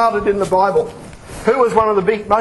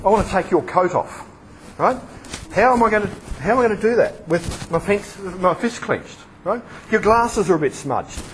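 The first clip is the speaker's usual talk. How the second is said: usual speaking voice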